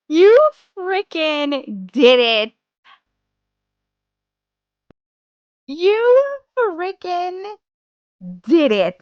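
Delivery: slow, drawn-out, emotional disbelief; overwhelmed. Real, not acted. Streamer to her chat who hit the goal, slow overwhelmed praise. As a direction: drag each word out, barely believing it.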